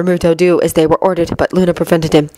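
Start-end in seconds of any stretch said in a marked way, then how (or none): none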